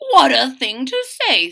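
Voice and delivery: loud, put-on father voice; father warlock voice. Papa Warlock answers Maggie, loud and affronted. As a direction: boom it out, offended dad. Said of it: "said Papa Warlock".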